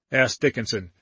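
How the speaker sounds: ordinary delivery, nothing marked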